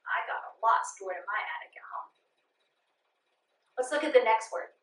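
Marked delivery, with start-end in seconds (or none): 0.1-2.1 whisper. Real, not acted. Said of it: "whispered personal aside".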